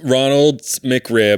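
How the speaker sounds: drawn-out and deliberate; slow. Real, not acted. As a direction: savor every word. Savoring the name.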